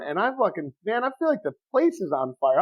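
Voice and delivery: ordinary delivery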